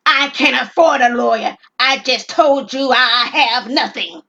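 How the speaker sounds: loud, slow exasperated retort; angry retort. Acted. Robber snapping back at the clerk about a lawyer, loud. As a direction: bite back at the suggestion.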